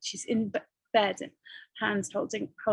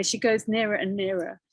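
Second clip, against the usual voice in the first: ordinary delivery, nothing marked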